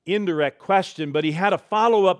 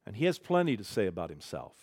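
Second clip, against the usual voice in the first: normal talking voice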